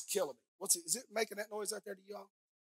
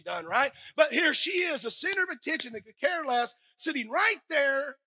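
louder, higher fervent sermon delivery; full-force preaching; 6 semitones higher, 8 dB louder than usual. Acted. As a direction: preach it out to the congregation.

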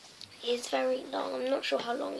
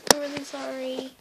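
ordinary talk, nothing marked

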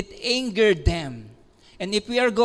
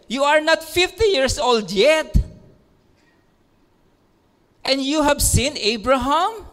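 mocking shout; 5 semitones higher than usual. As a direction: shout him down in disbelief.

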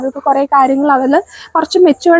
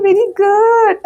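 delighted praise; 6 semitones higher, much slower than usual. Real, not acted. Mother praising her toddler for finding things, delighted. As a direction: lift the praise up high.

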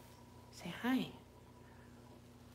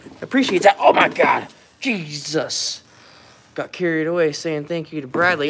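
ordinary talk

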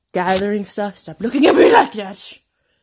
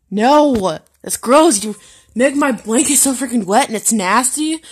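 ordinary talk

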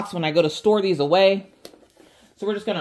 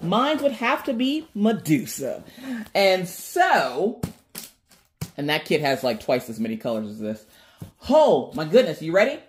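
usual speaking voice

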